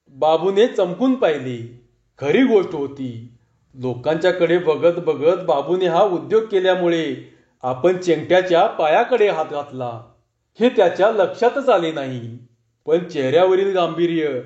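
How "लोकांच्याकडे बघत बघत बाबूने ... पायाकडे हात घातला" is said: plain reading voice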